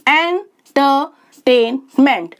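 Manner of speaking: Entertainment in four slow, separate chunks; syllable by syllable. Shown as a demonstration. Teacher repeating for learners, entertainment split syllable by syllable. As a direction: say entertainment slowly, pausing between syllables. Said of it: "शब्द को तोड़कर sound change".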